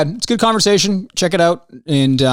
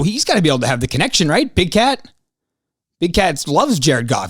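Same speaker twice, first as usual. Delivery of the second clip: ordinary talk, nothing marked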